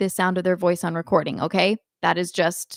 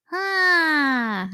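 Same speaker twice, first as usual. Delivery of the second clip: pinched nasal ah; 7 semitones higher than usual. Shown as a demonstration. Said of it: "You're never going".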